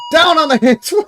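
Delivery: guard barking at the detective; guard shout. Acted. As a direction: stop him cold with a yell. Guard yells at intruder.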